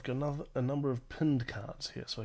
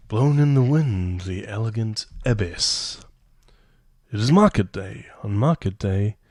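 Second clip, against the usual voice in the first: louder, measured card read; 11 dB louder than usual. Acted. Reads card text aloud.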